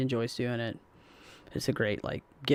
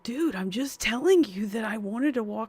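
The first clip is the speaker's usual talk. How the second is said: exasperated retort; 9 semitones higher, 4 dB louder than usual. Retold or imitated. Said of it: "I'm just sitting there like".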